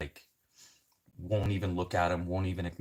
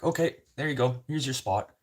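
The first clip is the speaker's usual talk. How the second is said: cheerful driver; 8 semitones higher, 6 dB louder than usual. Retold or imitated. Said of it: "The guy's like".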